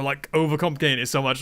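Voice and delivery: usual speaking voice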